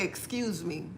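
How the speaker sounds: very soft, low little aside; demure apology. Retold or imitated. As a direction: drop right down for a polite pardon. Retells her apology after cussing.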